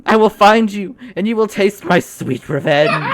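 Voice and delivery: loud hammy vow of vengeance; melodramatic outrage. Pretend. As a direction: shout it like a stage hero.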